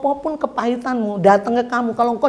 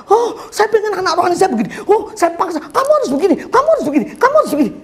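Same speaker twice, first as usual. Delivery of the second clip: building, higher and pushier with each repeat; pushy insistence; 7 semitones higher, faster than usual. Retold or imitated. Leader pressing his spiritual child, insistent and mounting. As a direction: lean harder on each kamu harus begini. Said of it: "saya pengen greget gitu ya".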